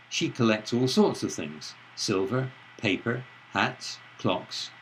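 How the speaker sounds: long pauses after things, silver, paper and hats; pauses as marked. Shown as a demonstration. Lecturer reads a list with long pauses. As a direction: pause clearly between every listed item. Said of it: "with longer pauses in the places indicated by the dots".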